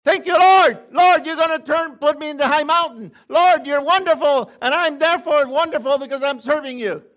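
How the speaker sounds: loud, high, exultant thanks; joyful praise. Pretend. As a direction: praise the Lord with everything you've got. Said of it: "change the speech to".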